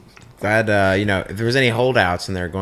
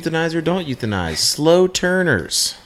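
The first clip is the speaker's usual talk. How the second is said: game show host; 7 semitones higher than usual. Pretend. Host introducing Euthanize or Don't Euthanize, game show announcer.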